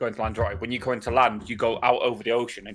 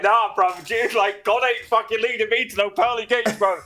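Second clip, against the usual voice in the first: mock protest; 8 semitones higher than usual. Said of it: "And I'm like".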